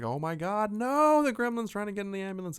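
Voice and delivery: high, loud, fast hammed-up terror; a frantic passenger who sees the gremlin; shrieking in mock horror; mock panic. Panicked Twilight Zone character.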